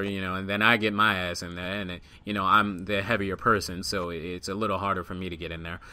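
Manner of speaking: ordinary delivery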